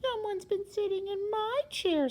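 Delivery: put-on small, high baby bear; wee little voice. A narrator's reading. Baby Bear finds his chair used, high wee voice. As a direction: make it tiny and high. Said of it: "said Baby Bear in his wee little voice".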